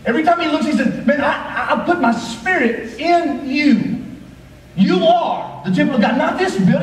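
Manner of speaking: high, driving preacher cadence; full-force preaching. Acted. Pastor urging the church about the body, preaching at full force. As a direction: pastor pressing the congregation, preaching hard.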